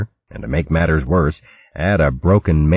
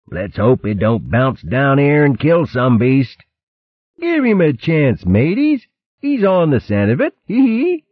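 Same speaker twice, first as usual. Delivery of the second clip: much higher, snickering vermin; sniggering crewman; 10 semitones higher than usual. Acted. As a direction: tease with a giggle. Crew mock the captain.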